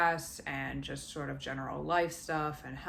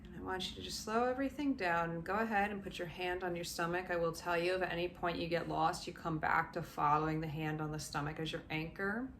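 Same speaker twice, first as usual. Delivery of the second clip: guided meditation. Acted. Coach guiding listeners through body relaxation, gently soothing. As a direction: ease them down into calm, step by step.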